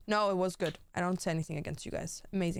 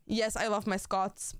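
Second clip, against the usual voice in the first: ordinary delivery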